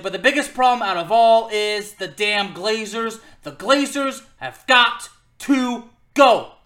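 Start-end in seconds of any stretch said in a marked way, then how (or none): 3.4-6.6 emphatic. Real, not acted. Fan's protest chant.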